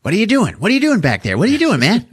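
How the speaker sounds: high, insistent doll voice; Chucky. Retold or imitated. Doll head calling to Andy at the cabinet, high put-on voice. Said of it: "Chucky's like".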